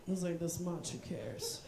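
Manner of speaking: low, quiet brush-off; soft, low imitation of an unbothered father; dismissive dad. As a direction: shrug it off under your breath as dad. Voices dad brushing off remark.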